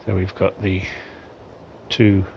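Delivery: ordinary talk, nothing marked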